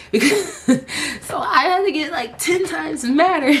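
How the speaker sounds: high, laughing through the words. Real, not acted. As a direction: giggle out how mad she got. Laughing while telling story.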